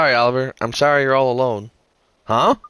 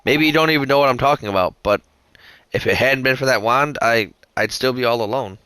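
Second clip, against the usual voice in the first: ordinary talk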